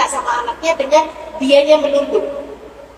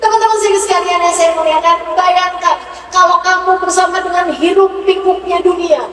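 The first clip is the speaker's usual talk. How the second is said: loud, raised sermon voice with music; fervent preaching; 7 semitones higher, 4 dB louder than usual. Acted. Preacher to the congregation, preaching loudly and fervently. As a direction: preach with rising passion.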